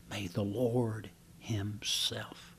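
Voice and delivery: slow, soft reverent whisper. Shown as a demonstration. Preacher to congregation, whispering the verse slowly. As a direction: breathe the words as a hushed prayer.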